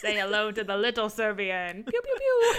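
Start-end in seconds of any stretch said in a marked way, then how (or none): 1.8-2.5 high squeaky pew pew; tiny gun pew. Pretend. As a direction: fire a toy gun in a squeak. Tiny gun firing noises.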